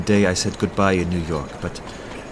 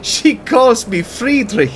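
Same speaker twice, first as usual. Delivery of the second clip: delighted laugh; 14 semitones higher, 5 dB louder, slower than usual. Acted. Delighted at hearing his name.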